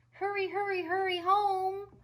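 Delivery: high, slow drawn-out call; small boy pleading after his sister; little brother calling. A narrator's reading. Little brother calls after Tilly, high and slow. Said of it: "her brother called".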